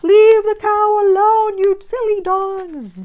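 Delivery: shrill, very loud and slow yell; screaming. Retold or imitated. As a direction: bellow at the dogs to back off. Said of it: "I'm screaming".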